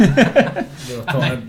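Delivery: slow, stretched through laughter; laughing. Pretend. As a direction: giggle out the words. Laughing mock reply.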